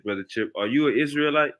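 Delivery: usual speaking voice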